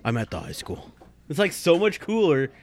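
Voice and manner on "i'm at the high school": low, clipped dispatch radio call; deadpan cop on the radio. Retold or imitated. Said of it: "my radio voice".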